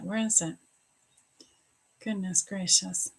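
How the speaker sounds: ordinary talk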